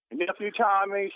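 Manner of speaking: ordinary delivery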